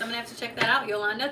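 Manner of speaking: ordinary talk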